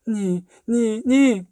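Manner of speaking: put-on high child's cry, rising with each word; frightened refusal. A narrator's reading. Girl in a trance refusing what she sees, frightened. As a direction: protest in rising panic, pitched high.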